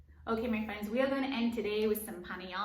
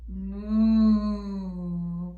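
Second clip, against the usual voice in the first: cow moo; 2 semitones lower, 9 dB louder than usual. Pretend. Teacher mooing for the cow yoga pose, loud.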